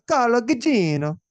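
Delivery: exaggerated Italian accent, pitched high; thick Italian accent. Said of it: "if I do it in a thick Italian accent".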